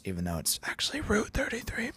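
mock secret whisper; whispered aside. Stage whisper aside about name.

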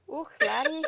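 mock Scottish exclamation; bad Scottish accent. Host told to try Scottish, bad Scottish accent. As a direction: have a half-hearted go at Scottish. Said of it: "It's about as Scottish as I get".

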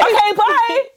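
high, quick put-on goodbye; mock cheery sign-off. Ending the pretend call with Samuel, mock cheery. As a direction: sing out the farewell far too brightly. Acting out the prank call's ending.

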